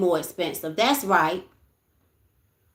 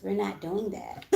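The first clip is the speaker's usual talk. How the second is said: normal talking voice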